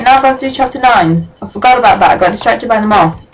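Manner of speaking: ordinary talk, nothing marked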